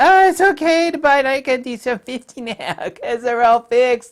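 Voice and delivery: high, loud airy dismissive voice; mocking the crowd. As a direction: parody the naive buyers. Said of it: "Everybody said".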